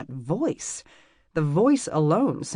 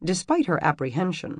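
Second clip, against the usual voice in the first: plain reading voice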